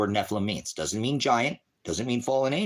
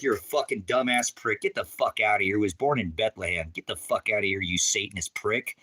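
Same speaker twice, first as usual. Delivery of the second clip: ordinary talk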